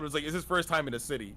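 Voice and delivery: normal talking voice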